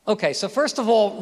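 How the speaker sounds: ordinary talk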